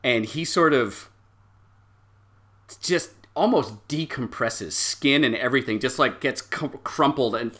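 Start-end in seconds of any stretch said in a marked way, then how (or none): none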